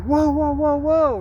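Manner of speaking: loud, high alarmed whoas; re-live the car nearly hitting you; yelling whoa at a swerving car; startled whoa. Retold or imitated. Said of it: "And I'm like".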